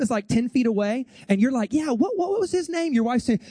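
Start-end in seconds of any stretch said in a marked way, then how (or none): 1.7-2.9 searching husband. Acted. Husband groping for a name.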